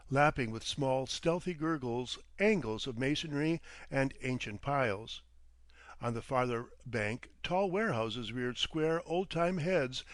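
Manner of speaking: ordinary delivery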